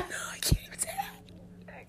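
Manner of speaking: soft embarrassed whisper; whispered aside. Real, not acted. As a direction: whisper it under your breath.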